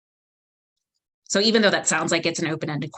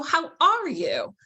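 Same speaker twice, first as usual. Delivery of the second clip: high, slow, "are" stressed; open, light "How ARE you?"; 9 semitones higher, slower than usual. Shown as a demonstration. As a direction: lift and lean on "are".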